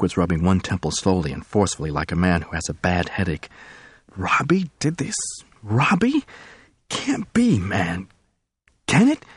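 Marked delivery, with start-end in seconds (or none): 4.2-9.2 high, stunned and pleading; disbelieving and near tears; stunned disbelief. Acted. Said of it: "pitiful, stunned intensity".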